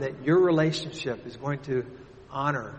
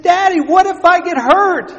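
put-on scared little girl; 14 dB louder than usual. Trapped daughter calling down to her father, frightened. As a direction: plead like a terrified little girl. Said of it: "She said".

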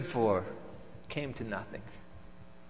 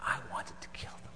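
whispered confession; 4 semitones lower, 14 dB quieter, faster than usual. Acted. Pharisee confessing to Pontius he wanted them dead, whispered. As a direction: breathe the dark secret out.

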